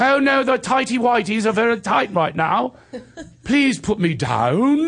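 high, mock-British pleading, ending drawn out; hammed-up proper British distress; King's English. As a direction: protest in exaggerated King's English. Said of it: "Here you go, King's English".

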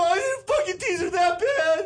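high, loud whiny protest; defensive kid voice. Retold or imitated. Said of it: "and I go".

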